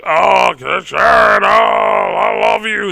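high, loud, slow and warped voice; overjoyed crazed villain. Acted. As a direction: gush over her like a mad lover. Strange greeting revived woman, acted.

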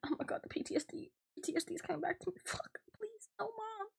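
high, hushed whisper of mock dread; whispered panic, pleading with Mom; panicked whisper. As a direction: breathe it out like a scared kid. Narrator's panicked inner voice.

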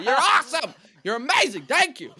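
high, loud gushing yell; shouting for grandma. Retold or imitated. Grandson praising his deaf grandma, comic shouting. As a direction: bellow the praise so she can hear. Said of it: "you kinda have to shout at her".

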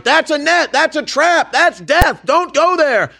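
high, loud pleading warning; desperate warning. Retold or imitated. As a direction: beg them to stop, calling out. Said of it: "and you're like".

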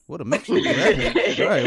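laughing through the words; amused, chuckling reaction. Real, not acted. Laughs at sex topic.

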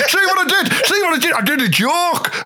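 high, giggly delight in own pun; real high-pitched laughing, pleased with the gag; laughing at own joke. Real, not acted. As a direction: squeal it through laughter, pitch way up.

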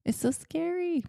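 high mock-fearful squeal; a playful high-pitched fake fear; mock scary. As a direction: play up the fright with a high squeak. Teasing that talking is scary.

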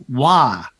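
"wire" drawn out slowly as "wah"; father-in-law's pronunciation. Retold or imitated. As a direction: say "wire" as a long "waah". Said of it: "father-in-law, which is, has got a very particular voice".

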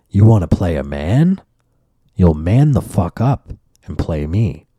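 slow, low and steady; cold threat. Retold or imitated. Grandma daring the neighbor, slow and low. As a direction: lay down the challenge coldly. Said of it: "My grandma's like".

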